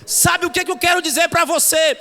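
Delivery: shouted, slow, high sermon; fiery preacher shouting slowly; full-force preaching. Acted. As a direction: drive the message home to the congregation.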